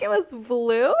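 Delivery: high, loud, disbelieving question; incredulous question. Retold or imitated. She questions her friend about the car's color, incredulous.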